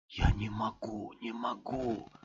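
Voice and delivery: breathless hopeless murmur; hushed repeated plea; despairing whisper. A narrator's reading. Repeated despair after mumble.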